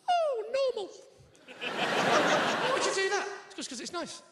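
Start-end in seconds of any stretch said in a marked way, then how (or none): 0.1-0.9 mock approval. Husband reacting to wife's keepsakes, sarcastic. 1.6-3.3 high, slow incredulous question; baffled disbelief. Acted. Husband questioning wife's bag of hair, incredulous.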